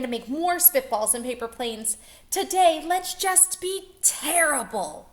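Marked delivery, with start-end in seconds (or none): 2.3-5.1 put-on high, loud gleeful child voice; naughty kids cheering; excited kids. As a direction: plot mischief with delight. Kids voiced, mischievous glee.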